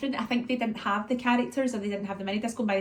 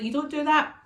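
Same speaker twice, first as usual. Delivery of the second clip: higher, firm mum voice; retold telling-off; 7 semitones higher, 4 dB louder than usual. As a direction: tell a toddler off, pitched up. Mum retells telling off toddler.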